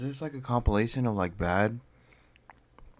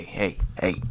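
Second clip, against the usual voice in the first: ordinary talk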